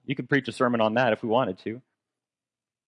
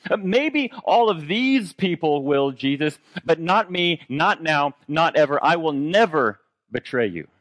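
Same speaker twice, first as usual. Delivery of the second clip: higher, slower, insistent boast; mock-earnest vow, pitched up; boastful Peter; 6 semitones higher, 4 dB louder than usual. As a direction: protest loyalty with full conviction.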